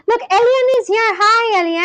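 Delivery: high, loud, delighted hello; light up as your friend walks in; excited greeting. Real, not acted. Friend joins the call, greeting.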